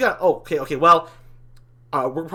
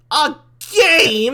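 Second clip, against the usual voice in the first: high, loud incredulous cry; loud disbelief; 7 semitones higher, 7 dB louder than usual. Acted. Demon mocking the angel's idea of a game, loud disbelief. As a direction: throw it back in disbelief.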